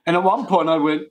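ordinary talk, nothing marked

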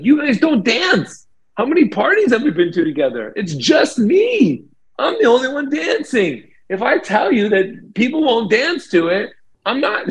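very high, exasperated mock yell; retold screaming match. Arguing that the band doesn't dance, a very high exasperated retold yell. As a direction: squeal your frustration at the band. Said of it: "we're all screaming at each other".

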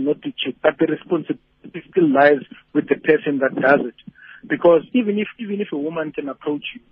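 ordinary talk, nothing marked

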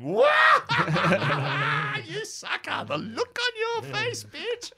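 high, loud cackling jeer; mocking laughing shout. Liaison officer bursting in to mock him, laughing shout. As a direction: crow with laughter at the sucker. Tab laughing at his prank.

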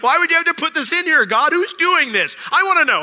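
high, loud disbelieving cry, fast; incredulous outcry. Retold or imitated. Preacher asking God, incredulous and loud. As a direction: exclaim it in amazed disbelief. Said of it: "I'm like".

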